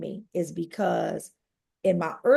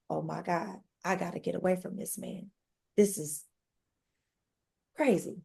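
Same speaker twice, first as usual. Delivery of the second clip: stunned hush; 3 semitones lower, 8 dB quieter than usual. Retold or imitated. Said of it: "So I'm looking like".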